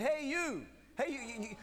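high, loud usher call. Retold or imitated. Usher calling the hiding teen, loud high call. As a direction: call him out from the aisle.

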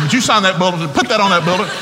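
loud, high, chuckling ask; laughing request. Retold or imitated. Fan asking Barry Sanders to sign, loud laughing request. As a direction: beg through laughter.

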